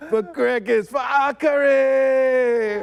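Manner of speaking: high, ringing war cry with a catch of laughter; rally the troops in a slow, loud cry; battle cry. Acted. Panelist voicing Draka calls out to her fallen, loud. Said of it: "Okay, [name]".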